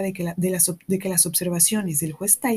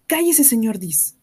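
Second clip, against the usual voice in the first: ordinary delivery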